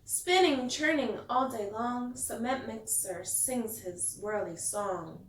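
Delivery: lively picture-book read-aloud; storyteller reading to kids; reading to children. A narrator's reading. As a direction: read it like bedtime story time.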